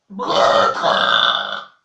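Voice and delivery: a single burped word. Shown as a demonstration. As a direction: say "Sestra" on a burp. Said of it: "en rotant".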